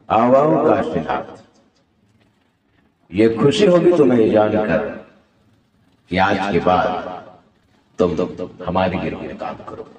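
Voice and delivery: low film-villain voice, measured pauses; Danny. Acted. Villain welcoming a recruit into his gang, Danny impression. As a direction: speak low and deliberate, like a gang boss. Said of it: "हाँ, तो वो Danny villain के।".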